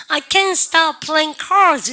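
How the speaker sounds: a high, loud, drawn-out cry of I can't stop playing cards. Shown as a demonstration. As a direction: call it out like a desperate card addict. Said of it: "막 외쳐요".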